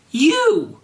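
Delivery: high shrieking yell. Acted. Draco recognises Garfield, high shrieking yell. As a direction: shriek it out in accusation. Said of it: "yelled Draco".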